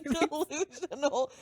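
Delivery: words spilling through a laugh; giggling while agreeing. Real, not acted. Co-host cracking up at the reading, laughing through her words.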